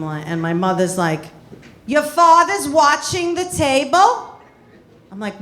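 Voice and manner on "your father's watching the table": mother voice. Retold or imitated.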